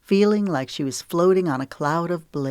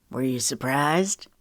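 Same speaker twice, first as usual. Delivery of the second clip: soft male voice; 3 semitones lower than usual. A narrator's reading. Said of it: "he asked softly".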